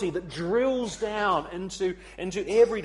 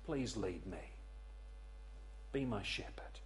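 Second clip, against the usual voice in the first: soft, low prayer with pauses; humble, lowered entreaty; hushed plea; 8 semitones lower, 15 dB quieter than usual. Acted. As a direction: drop right down and plead quietly.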